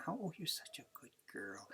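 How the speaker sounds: breathy praise to a dog; whispered good-girl praise; soft whisper. Shown as a demonstration. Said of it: "I have dogs they just whisper to".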